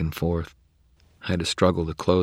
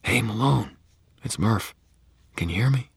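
called gently; 6 semitones higher than usual. Acted. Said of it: "she called gently".

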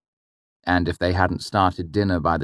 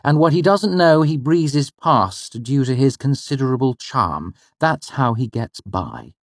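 a lightly voiced mother, higher; knowing and a little amused; mother's voice; 8 semitones higher than usual. The mother explaining the father to her son, knowingly. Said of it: "my mother said".

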